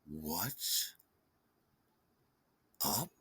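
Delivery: low, quiet, icy-slow retort; irritated deadpan. Retold or imitated. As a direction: leave a long pause between the two words. Irritated deadpan reply to chirpy greeting.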